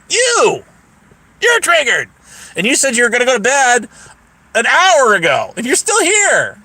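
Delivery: high-pitched, needling comeback; mocking, indignant callout; retold accusation. Acted accusation in retold quarrel.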